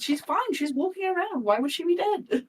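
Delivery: high-pitched mock disbelief; incredulous protest. Cleric scoffing at the idea she could be dead, incredulous. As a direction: protest as if it's obvious. Mock quote of oblivious onlooker.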